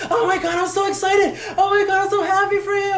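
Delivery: high, loud excited squeal imitating a sister; super excited. Sister hearing her brother's news, super excited. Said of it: "she was super excited".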